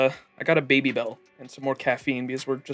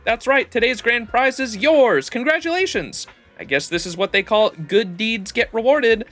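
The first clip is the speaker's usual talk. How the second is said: booming prize announcer; 6 semitones higher than usual. Acted. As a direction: announce the winner proudly. Reads raffle announcer lines.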